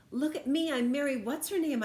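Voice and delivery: high, loud show of courage; a stuck kid hollering to sound brave. Acted. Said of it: "I hollered, trying to sound brave".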